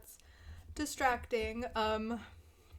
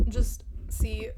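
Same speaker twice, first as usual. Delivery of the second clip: whisper; 6 dB louder than usual. Acted. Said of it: "A S M R".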